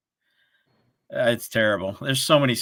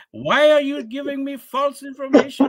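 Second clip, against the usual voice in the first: much higher, put-on indignant caller voice; mock furious scammer. Scam caller angry at the fake number, hammed-up high outrage. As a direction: squeal out the caller's outrage like a parody. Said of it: "This guy was pissed".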